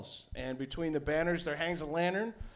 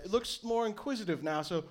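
usual speaking voice

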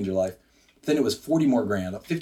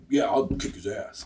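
slow, cocky swagger; tough-guy bravado; 2 semitones lower than usual. Retold or imitated. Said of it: "like, I".

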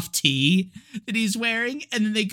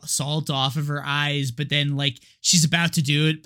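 ordinary delivery

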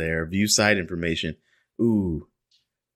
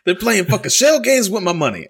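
higher, louder shell-game rant; imitating dad angry; 9 semitones higher, 4 dB louder than usual. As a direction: belt it up high like the outraged dad. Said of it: "that is one hundred percent the words".

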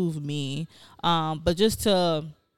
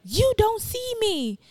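hurt plea; slower than usual. Retold or imitated. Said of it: "and I was like".

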